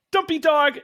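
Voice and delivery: put-on high girlish call; [name] calling out. [name] calls out to the mascot in a high voice. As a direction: call his name up high.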